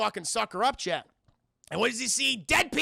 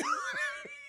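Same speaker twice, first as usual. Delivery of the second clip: high laugh; 7 dB quieter than usual. Real, not acted. Streamer laughing.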